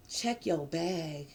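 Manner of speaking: a low, slow inner voice. Retold or imitated. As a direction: warn yourself quietly, slowly. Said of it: "something says".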